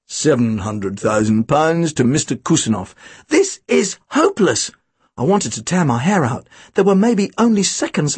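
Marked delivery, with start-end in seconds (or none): 0.1-2.9 plummy auctioneer. A narrator's reading. Auctioneer taking bids. 3.3-4.7 high, slow exasperation; exasperated. A narrator's reading. Boy despairs as seconds run out, exasperated. Boy frustrated as time runs out.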